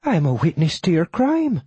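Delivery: high-voiced old Irish widow; accusing widow. Widow tells killer she witnessed it, high accusing voice. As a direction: accuse him in a high character voice.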